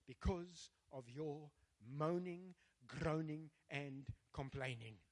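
low, slow, word by word; grave, drawn-out scripture pronouncement; solemn verdict. Real, not acted. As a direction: let each word fall heavily. Solemn weighty end of God's verdict.

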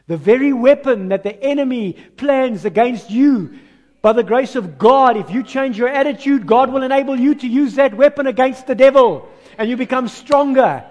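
loud, building proclamation; preaching at full force. Real, not acted. Preacher exhorting church about using enemy's weapon, loud. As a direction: drive the point home to the congregation. Preacher driving home the principle.

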